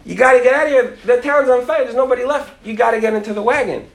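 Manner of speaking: high, urgent mock holler; screaming from a wagon. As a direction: urge them aboard, pitched high. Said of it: "he hears the guy scream out of the wagon".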